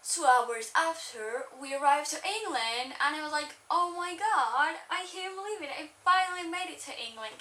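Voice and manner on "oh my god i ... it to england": excited. Retold or imitated. Arrival excitement retold.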